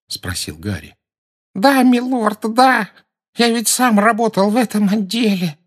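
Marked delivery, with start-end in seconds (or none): 1.5-5.6 high, nasal, begging whine; nasal pleading servant. A narrator's reading. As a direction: grovel through the nose. Servant Rookwood answers. (гнусавый умоляющий голос)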